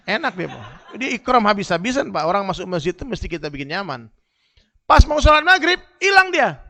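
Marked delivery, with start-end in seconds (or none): 4.9-6.6 loud, high storytelling burst; spring the surprise on the crowd; high and loud reveal; excited punchline. Acted.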